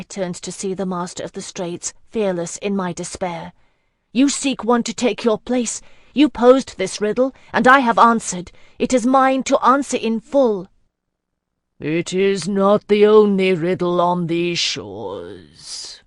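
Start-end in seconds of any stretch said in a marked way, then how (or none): 4.1-10.7 loud and higher, defiant; fearless defiance. Acted. Heroine claiming the riddle from the Master, loud and defiant. 11.8-16.0 slow, grave voice of an ageless sea lord; ancient sea lord. Acted. Sea lord questioning the gathered mortals, slow and grave. Master of the Straits speaks.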